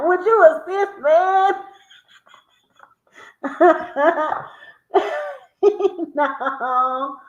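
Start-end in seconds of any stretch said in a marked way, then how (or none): 0.1-1.5 high laughing blurt; laughing guess. Real, not acted. Laughing at own wrong answer, high and loud. Mishearing the riddle.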